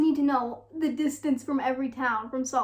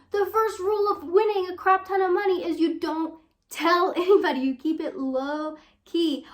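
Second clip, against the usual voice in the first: loud, high scolding friend voice; exasperated Roxanne; 4 semitones higher, 7 dB louder than usual. Acted. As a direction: scold her friend for flaunting the money. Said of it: "Roxanne tells us".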